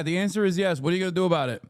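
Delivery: usual speaking voice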